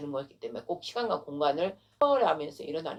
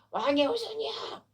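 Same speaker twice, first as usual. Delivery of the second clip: imitated call; 8 semitones higher than usual. Acting the beggar's cry.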